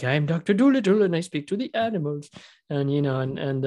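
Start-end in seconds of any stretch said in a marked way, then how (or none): 0.0-2.3 put-on higher, grand old-timey announcement; a hammy vintage-film hero; Doctor Dolittle impression. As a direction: proclaim it like a stagey period doctor. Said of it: "He was like".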